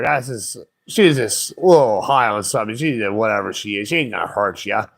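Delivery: low, unimpressed voice; dismissive impersonation. Retold or imitated. Man to panicking drivers, dismissive.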